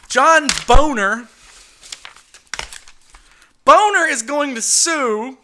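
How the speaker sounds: loud, slow, drawn out; slow dramatic emphasis. Real, not acted. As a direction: land each word heavily.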